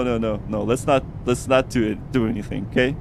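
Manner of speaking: ordinary talk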